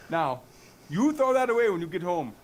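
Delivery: high, loud African-sounding attempt at Canadian; stern telling-off in a badly faked accent; botched Canadian accent. Canadian cop scolds meth user, stern botched accent. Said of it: "Is he African now?".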